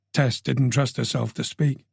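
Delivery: ordinary delivery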